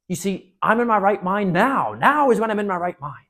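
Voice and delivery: loud, high, desperate begging; frantic plea. Acted. Enchanted prince pleading with the children to untie him, frantic. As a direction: insist you're sane, pleading hard.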